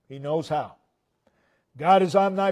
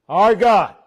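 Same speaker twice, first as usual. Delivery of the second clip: louder, stress on God; emphatic; 4 dB louder than usual. Shown as a demonstration. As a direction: proclaim God firmly. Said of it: "those two words".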